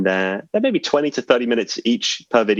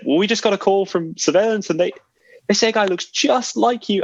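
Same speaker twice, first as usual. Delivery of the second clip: higher mock official voice; imitating casino staffer; 11 semitones higher than usual. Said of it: "he said".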